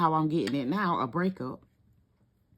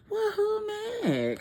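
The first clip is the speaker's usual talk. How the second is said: much higher, slow, laughing through it; laughing disbelief; 14 semitones higher than usual. Real, not acted. Reader repeating her question to the cards, laughing high. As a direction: crack up as you ask again.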